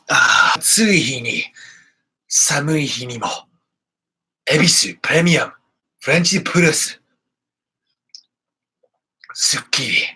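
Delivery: Japanese beer advert read; mock commercial. Host faking a beer sponsor break, commercial voice. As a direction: play the announcer of a beer commercial. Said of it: "that was a good commercial".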